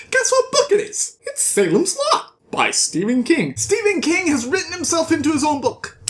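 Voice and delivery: high-pitched, incredulous glee; excited reveal. Real, not acted. Storyteller revealing the author wrote himself in, excited squeal. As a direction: burst out with it, high and thrilled.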